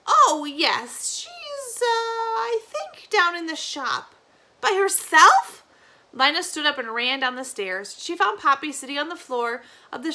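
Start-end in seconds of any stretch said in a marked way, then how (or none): 0.1-4.1 put-on slow, high, hesitant old woman; flustered granny. Granny realising she forgot the baby, hesitant. 4.6-5.6 loud, high, slow shocked question; horrified disbelief; alarmed. Acted. Lina learning the baby is alone, alarmed.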